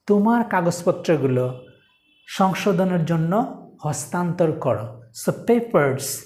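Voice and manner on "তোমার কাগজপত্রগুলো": normal talking voice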